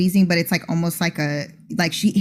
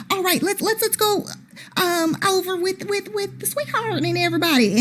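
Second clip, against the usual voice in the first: high, softer Southern belle, hurrying off; bad Southern accent; 13 semitones higher, 4 dB quieter than usual. [name] pulling Barry away in a bad Southern accent. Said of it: "that bad Southern accent".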